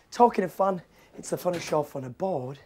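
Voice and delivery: ordinary delivery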